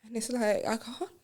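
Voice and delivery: quiet, hesitant apology; hushed apology. Acted. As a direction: say it softly, ashamed.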